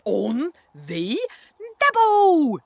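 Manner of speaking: put-on loud order leaping up high, funny accent; funny-accent shout. A narrator's reading. Mister Accent in your face, shouting in funny accent. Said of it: "he shouts".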